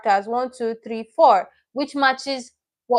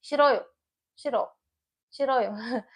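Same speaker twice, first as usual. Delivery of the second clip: playful refusal; 6 dB quieter than usual. Pretend. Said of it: "feel free to say".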